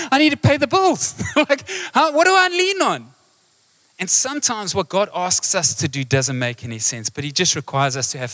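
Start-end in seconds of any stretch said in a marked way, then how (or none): 0.1-3.1 high, laughing mock panic; squeaky, comically desperate objection; exasperated protest. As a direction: plead in hammed-up bewilderment. Acted panicked reply about the bills.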